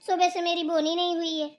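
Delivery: usual speaking voice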